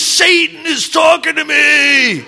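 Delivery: slow, high and loud declaration; ominous shout. Retold or imitated. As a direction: spell it out like a frightened confession. Andy confessing on the phone.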